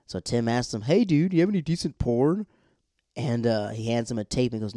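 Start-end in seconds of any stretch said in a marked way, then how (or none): 0.8-2.5 higher, louder put-on dude voice; imitated dude. Tim asks Nathan for a tape, imitated dude voice. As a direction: imitate an eager buddy asking a favor. Tim's request to Nathan acted out.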